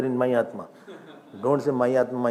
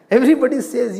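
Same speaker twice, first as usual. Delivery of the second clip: ordinary delivery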